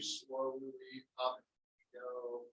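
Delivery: slow, soft and drawn out; careful unhurried voice. Shown as a demonstration. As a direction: ease it up slowly. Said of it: "Just goes nice and slow".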